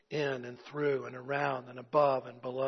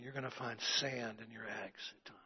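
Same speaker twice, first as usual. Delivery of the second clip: hushed unvoiced aside; whisper; 5 semitones lower, 13 dB quieter than usual. Real, not acted. Preacher whispering the punchline to the congregation. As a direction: lean in and whisper it.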